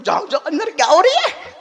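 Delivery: loud, high-pitched nosy questioning; hammed-up curiosity. Preacher imitating old folk at a forbidden curtain, loudly curious. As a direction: play an onlooker itching to peek.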